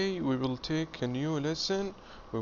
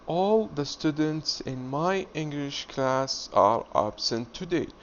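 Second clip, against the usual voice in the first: slow, careful model reading; teacher reading slowly for the class. Shown as a demonstration. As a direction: read it slowly so students can follow. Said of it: "I will read slowly".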